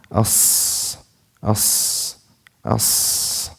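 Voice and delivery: AS with a long hissed S; sustained airy S closing AS; breath on sad. Shown as a demonstration. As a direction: let breath run through the S of AS.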